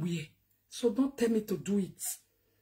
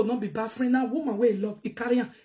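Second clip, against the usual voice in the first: ordinary talk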